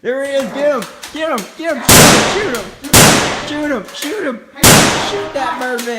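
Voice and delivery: frantic high shouting; panicking gamer. Pretend. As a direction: yell it in a total panic. Kids fleeing pretend Birdman attack.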